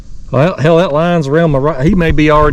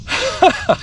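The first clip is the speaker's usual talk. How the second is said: short, very high laugh; happy giggle. Real, not acted. Angler before releasing fish, short high giggle.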